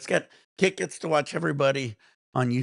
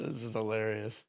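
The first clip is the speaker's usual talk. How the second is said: normal talking voice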